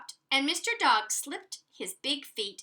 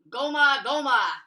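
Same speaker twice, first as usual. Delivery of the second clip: drum call; 2 semitones higher, 6 dB louder than usual. Acted. Drum beat sound words.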